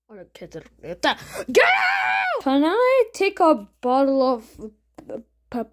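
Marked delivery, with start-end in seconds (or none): 1.5-2.4 frantic high yells to chase him off; high shouting. Acted. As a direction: drive him out with screams. Chasing him off.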